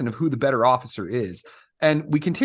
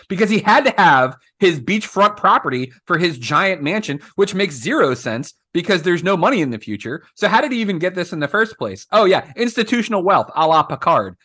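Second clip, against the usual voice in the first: worked-up, indignant ranting with higher pitch; heated rant; 3 semitones higher than usual. Acted. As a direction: rant in exasperation, pitch jumping up. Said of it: "Well, I'm heated, obviously".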